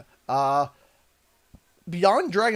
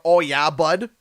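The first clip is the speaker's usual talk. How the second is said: put-on Canadian accent; 8 dB louder, slower than usual. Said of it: "that's Canadian right there".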